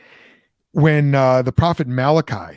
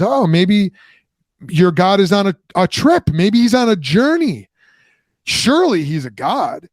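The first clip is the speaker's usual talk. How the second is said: higher, sing-song mock concern; a higher, sneering retold mockery; mocking taunt; 6 semitones higher, 3 dB louder than usual. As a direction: taunt them about their absent god, pitched up. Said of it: "he starts mocking them".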